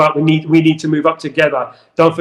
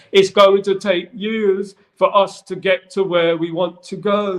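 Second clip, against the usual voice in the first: slow, higher mock-Hobbit accent; odd storybook accent, pitched up and slow; put-on Hobbit-like accent; 4 semitones higher, slower than usual. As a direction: quote him in a drawn-out, made-up Hobbit accent. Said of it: "Not necessarily in that accent".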